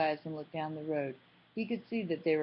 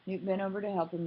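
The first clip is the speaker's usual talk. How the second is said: ordinary delivery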